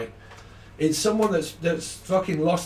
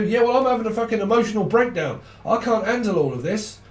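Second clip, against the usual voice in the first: higher, fast, overwrought wail; a partner melting down over being ignored; mock emotional breakdown; 7 semitones higher, 3 dB louder than usual. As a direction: ham up a partner falling apart. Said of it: "voicing the partner's breakdown".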